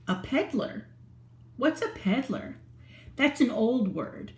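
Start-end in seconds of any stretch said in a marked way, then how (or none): none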